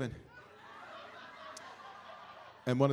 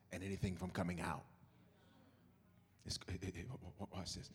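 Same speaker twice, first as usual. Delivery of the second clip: much lower and softer, almost a hush; low, soft, hushed tomb scene; hushed storytelling; 7 semitones lower, 9 dB quieter than usual. Acted. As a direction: draw them into the dark tomb. Hushed dramatic tomb description.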